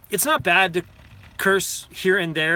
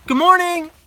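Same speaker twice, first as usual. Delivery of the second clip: bright, high, loud good morning call; high, loud cheerful greeting; cheery greeting; 12 semitones higher, 7 dB louder than usual. Retold or imitated. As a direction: call out a super friendly hello. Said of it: "I'm like".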